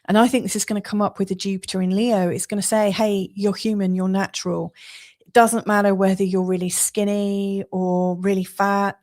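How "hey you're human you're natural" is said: ordinary talk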